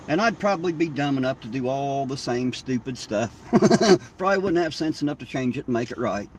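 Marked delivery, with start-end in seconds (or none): 3.4-4.1 a quick, loud, high burst of laughter; short laugh. Real, not acted. Laughing at himself over repeating stupid mistakes, short loud laugh. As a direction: laugh at your own foolishness. Laughs at his own foolishness.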